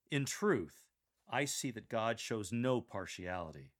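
"truth" and "no" punched, said with conviction; "truth" set apart, then "no" emphasized; stress on truth, no. Shown as a demonstration. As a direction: lean hard on "truth" and "no". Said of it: "Emphasize truth and no".

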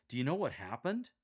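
excited question to a best friend; eager, lifting pitch on the question; thrilled. Shown as a demonstration. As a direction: burst out with thrilling news. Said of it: "Mirror that pitch and expression".

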